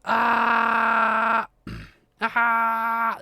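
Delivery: high loud "aha" of discovery; triumphant shout. Real, not acted. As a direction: shout it like you just found it.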